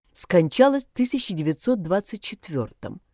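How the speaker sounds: ordinary delivery, nothing marked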